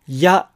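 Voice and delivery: や said with a stronger, harder y onset. Shown as a demonstration. Teacher showing how や hardens when stressed. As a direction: push the y in や toward a j sound. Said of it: "ちょっと強めになると".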